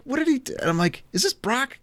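asking in growing irritation; retold annoyance. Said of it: "I was getting mad".

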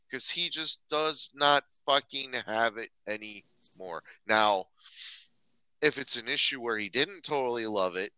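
ordinary delivery

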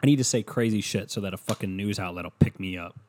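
normal talking voice

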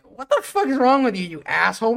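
high, loud and indignant; hammed-up angry outburst; mock outraged yell. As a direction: yell it like a cheated wrestler. Wrestlers' outraged retort.

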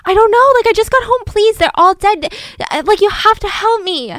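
high, loud, frantic begging; desperate plea. Retold or imitated. Son begging the neighbor for help, high and loud. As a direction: plead in full panic.

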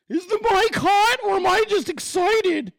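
very high, loud yelp into the mic; high-pitched excited shout; excited yelling. Real, not acted. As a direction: yell it way up high, all worked up. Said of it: "I'm just yelling louder than normal".

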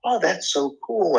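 pitched high with mock wonder; amazed. Trainer retelling his college self marveling at the scan, high and amazed. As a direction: retell your own wide-eyed gasp of delight. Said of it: "I was like".

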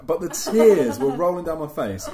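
can barely hold the giggles in; laughing. Real, not acted. Friend recalls the boss call, laughing hard. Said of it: "I was crying with laughter".

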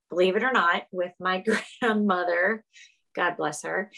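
usual speaking voice

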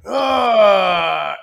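loud strained ugh as the shock hits; jolted groan; pained grunt. Retold or imitated. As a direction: grunt like you just got zapped. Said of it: "I went like this".

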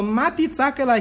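teacher booming God's words to the class; emphatic quote. Retold or imitated. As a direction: fling the words out as God's challenge. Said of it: "Então, Deus falou".

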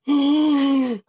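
loud, high acted gasp; awestruck gasp. Kid sees a Bigfoot at the window, awestruck gasp. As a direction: gasp big and high in amazement.

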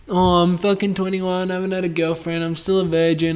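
a hint of a self-pitying lonely guy; half-hearted mimic of a moping twenty-one-year-old; mock whiny dude. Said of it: "dudes out there that are the fucking same".

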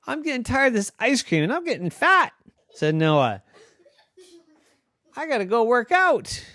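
put-on high, fast, whiny girl voice; arguing girl Noah. Noah demanding a big house.

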